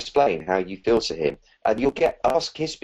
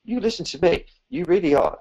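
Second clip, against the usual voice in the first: higher, firm, gentle insisting; insistent kind voice; 11 semitones higher, 3 dB louder than usual. Shown as a demonstration. Cutting off your own arguing, insistent and kind. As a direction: overrule your own doubts, kindly but firmly. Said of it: "you go".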